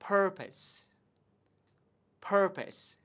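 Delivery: "purpose" said as PUR-pus, high and clear; model pronunciation. Shown as a demonstration. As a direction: say "purpose" with a short unstressed second syllable. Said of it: "Rất nhiều bạn đọc từ này là từ purpose". (phát âm mẫu "purpose" như "pơ-pợt")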